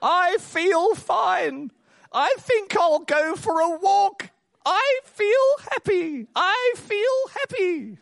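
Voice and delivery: put-on very slow, high, drawn-out old man; sing-song it high and stretched, insisting. Old man trying to avoid the cart, high drawn-out protest. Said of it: "this old man says".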